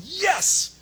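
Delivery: high, loud yes; Paul waking from his vision, high, loud yes; excited yes. Retold or imitated. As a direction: jolt awake with a joyful yes. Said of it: "sitting up in the middle of the night and going".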